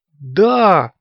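high, loud exclamation; exclaiming. A narrator's reading. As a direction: burst out with a sudden realization. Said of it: "воскликнул он".

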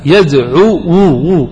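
forcing a damma onto the waw, yadʿuwu; teacher pushes a heavy u onto يدعو, drawing out وو; straining on the damma. Shown as a demonstration. Teacher demonstrates straining to voice يدعو with damma. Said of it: "ضع الضمة على الواو وانطق بها".

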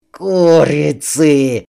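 slow groan through clenched teeth; through gritted teeth. A narrator's reading. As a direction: grind it out with a groan. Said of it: "сквозь стон заскрипел зубами".

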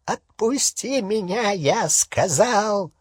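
high, slow, strained rasp; choked, growling demand; hoarse werewolf. A narrator's reading. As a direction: croak out an angry order for release. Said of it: "прохрипел Фенрир".

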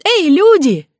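put-on high, loud childish yell; squeaky shout. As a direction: shout for help in a tiny voice. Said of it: "Уже кричу я".

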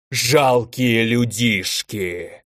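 slow, drawn-out monstrous contempt; furious elemental roar. A narrator's reading. Elder elemental in a rage at the humans, drawn-out roar. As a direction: stretch the insult like a raging giant. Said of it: "яростно заревел элементаль".

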